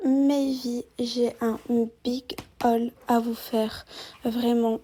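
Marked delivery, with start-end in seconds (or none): none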